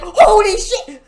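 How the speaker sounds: high, loud mock yell of shock. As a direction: burst out in exaggerated astonishment. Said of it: "when he's shocked".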